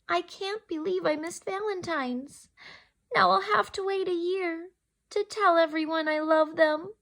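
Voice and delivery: put-on high, pleading child voice, upset; tearful Ruby. Ruby upset about missing Valentine's, high tearful voice. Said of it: "Ruby wiped away a tear".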